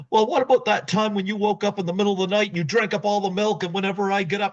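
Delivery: fast, high mock rant; rapid petty rant. Dewey deflecting onto his wife, a fast high rant. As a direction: rattle off the grievance fast and high.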